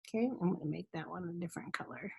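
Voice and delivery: fast whisper to herself; quick whispered note to self; whispered aside. Real, not acted. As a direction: mutter it under her breath. Aside while switching colors.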